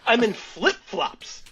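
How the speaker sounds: mock whining about flip-flops; mock whine. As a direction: whine it like a helpless guy. Joking about his shoes.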